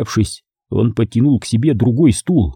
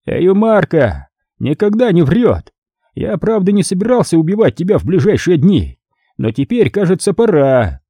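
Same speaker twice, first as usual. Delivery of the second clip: high-pitched cardinal pronouncing sentence; unruffled cardinal; 6 semitones higher than usual. Acted. Cardinal telling his captive it is time to die, high-voiced. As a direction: play the cardinal casually, pitched up.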